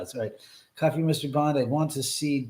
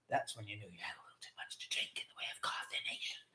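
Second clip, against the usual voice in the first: whisper; 5 semitones lower, 18 dB quieter, faster than usual. Pretend. Sudden aside.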